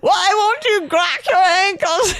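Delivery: high, loud, laughing imitation of scolding; exasperated mock anger. Bodywork woman scolding her client, mock-exasperated yell. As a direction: imitate an angry demand through laughter. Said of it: "did not shrink from displaying anger".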